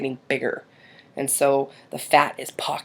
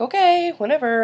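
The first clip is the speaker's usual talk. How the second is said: high, drawn-up okay dropping off; retold dismissive agreement; reluctant giving in; 10 semitones higher than usual. Mom retells giving in to her daughter, reluctant.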